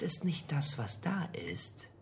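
hushed, slowed whisper for suspense; dramatic whisper. Acted. Lenz expert to his colleagues, whispering for suspense. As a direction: drop to a secret whisper. Lecturer builds dramatic pause.

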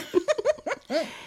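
high laugh falling off; amused laugh. Acted. Amused at the old word for TV hits, high laugh.

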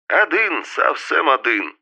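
hammed-up Georgian accent, slow on совсем; fake Georgian accent. A narrator's reading. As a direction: put on a joking Georgian accent. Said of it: "с фальшивым грузинским акцентом".

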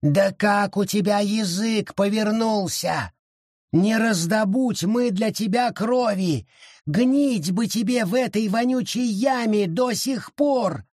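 high, raging creature voice; indignant tirade; furious forest spirit. A narrator's reading. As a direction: rage at his ingratitude. Forest rages at father.

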